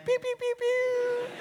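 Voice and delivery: high, playful pew-pew noises; finger-gun sound effects; mock gunshots. Pastor joking to the cashier, playful pew-pew gunshots.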